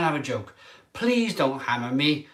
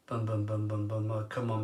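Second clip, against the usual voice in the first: low, much softer mumbled bums; low glum mumble to yourself; dejected muttering; 6 semitones lower, 10 dB quieter than usual. Real, not acted. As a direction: mutter under your breath, defeated.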